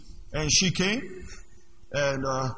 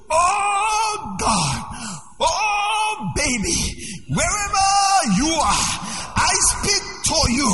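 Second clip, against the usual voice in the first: mock shouted prayer; 10 semitones higher, much slower than usual. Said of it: "what she wanted me to do".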